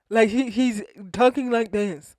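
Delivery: mocking, toothy imitation; talking through teeth. Vlogger shows how Chef D talks, mocking toothy speech. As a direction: talk as if big veneers fill your mouth.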